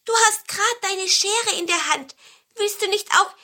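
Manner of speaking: high, eager begging; pleading pine cone. A narrator's reading. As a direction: ask a favour in a piping voice. Cone begs for his brothers. (hohe, bittende Zapfenstimme)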